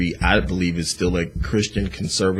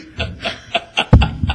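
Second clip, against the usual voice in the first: burst of laughter; 7 dB louder than usual. Real, not acted. Host laughs at donor wanting refund.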